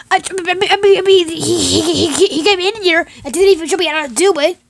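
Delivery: worked-up, tripping over the words; flustered complaint. Acted. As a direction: stammer it out high and fast, exasperated. Puppet character frantically complaining.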